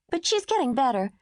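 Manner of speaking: put-on little girl telling about the sick lady; lightly voiced little girl. Mimi voiced as a child.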